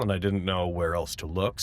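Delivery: ordinary talk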